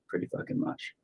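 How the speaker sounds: breathy whisper of "Pretty fucking much"; whispered repeat. Retold or imitated. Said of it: "I'll be like".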